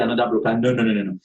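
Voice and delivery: normal talking voice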